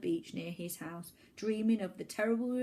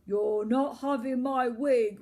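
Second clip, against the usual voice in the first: rumbled; 4 semitones higher, 8 dB louder, slower than usual. Acted. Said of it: "rumbled Cliff".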